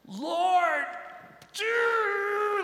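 high, loud pleading cry; desperate shout. Retold or imitated. Striving worshipper demanding God act now, desperate shout. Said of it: "where we're like".

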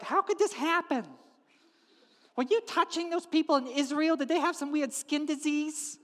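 high, agitated questioning; frantic wife. Acted. Wife questioning leprous husband, frantic.

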